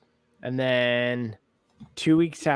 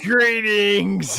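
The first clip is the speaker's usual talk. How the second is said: imitating monster greeting; 7 semitones higher, 4 dB louder than usual.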